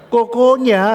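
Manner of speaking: very high, loud acted disbelief; incredulous echo. Pastor learns who the rival shop owner is, incredulous echo. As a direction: echo it back in amazement. Pastor echoes the surprising news.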